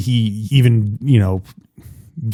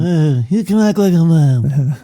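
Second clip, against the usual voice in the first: slow, higher take on the Don line; mock Godfather Don; 4 semitones higher than usual. Host repeats the Don's line slowly. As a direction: draw it out like the old Don. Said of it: "the old semi-retired Don".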